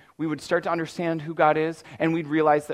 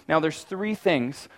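ordinary talk, nothing marked

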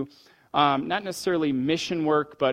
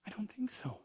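whispered; 5 semitones higher, 16 dB quieter than usual. Real, not acted. Preacher answers his own question.

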